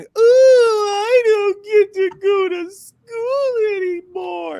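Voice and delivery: high, slow, mock sobbing whine; mocking crybaby wail. Mocking girls who cry about school with a high slow fake wail. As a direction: whine it like a fake crying child. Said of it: "completely mocking them".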